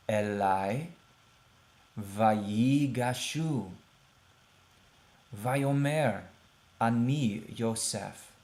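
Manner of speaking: each Hebrew word slow and apart; slow word-by-word reading; read slowly. Shown as a demonstration. Teacher reads slowly so students follow the text. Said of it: "read it slowly".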